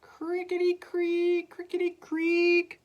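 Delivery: very high, squeaky creak; creaky rocking chair. Acted. Teacher rocks in her chair, high squeaky creak. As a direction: squeak slowly like old wood.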